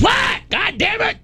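high, exasperated mock yell; yelling back. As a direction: holler it back across the yard. [name] yelling back across yard.

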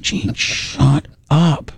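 very slow, stretched "Gene, shut up"; slow hushing. Retold or imitated. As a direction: drag each word out to hush him.

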